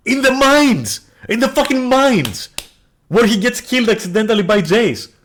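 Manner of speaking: high, loud, incredulous outburst; frustrated yelling. Real, not acted. Ranting to viewers about the son's fate, yelling. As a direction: yell in baffled outrage, stretching the key words. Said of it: "Sorry for yelling".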